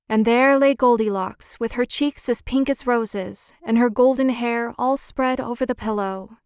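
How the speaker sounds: ordinary delivery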